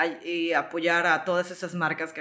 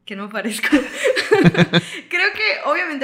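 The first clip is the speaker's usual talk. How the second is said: laughing; 9 semitones higher, 6 dB louder than usual. Real, not acted. Amused answer about her origin.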